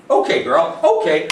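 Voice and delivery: bright high "Okay" to release the dog; high release cue. Shown as a demonstration. Trainer sings the release word to the puppy, high and bright. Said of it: "Okay releases her".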